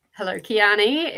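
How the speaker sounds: ordinary talk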